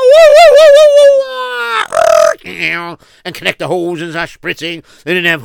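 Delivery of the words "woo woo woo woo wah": high, loud wailing siren noise; siren imitation. Narrator imitates a fire truck siren, high wailing. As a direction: wail like a fire engine.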